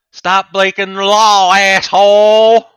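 furious slow roar at other drivers; dad stuck in traffic. Acted. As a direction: bellow it out, drawn out and enraged. Angry driver yells at others.

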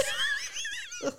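very high squealing laugh; helpless squeal of laughter; real laughing burst. Real, not acted. Cracking up at crawling story.

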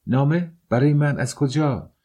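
high, rising, eager questions; surprised questioning. Acted. Lodger learning a letter has come, surprised. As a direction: ask in astonishment, pitched well up.

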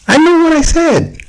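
insistent. Real, not acted. Insisting during argument.

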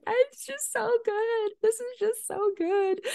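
high, drawn-out imitation crying voice; mock tearful. Retelling how she cried to her daughter over a show, mock tearful. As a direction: wail it lightly, as if overcome by tears. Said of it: "I was crying watching this show".

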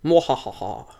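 low, soft villain cackle; evil laugh. Acted. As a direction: mwahaha like a cartoon baddie. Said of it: "Insert evil laugh".

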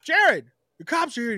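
high, mock-urgent call to someone in the house; imitated calling out. Retold shout interrupting the streamer.

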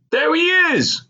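very high-pitched holler; excited scream. A narrator's reading. Hawk pointing out old schoolmate to young lady, screaming. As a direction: cry out as if spotting someone at last. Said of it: "Hawk screamed again".

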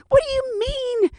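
high indignant shrieking complaint; furious, disbelieving and loud; outraged passenger. Retold or imitated. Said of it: "She goes".

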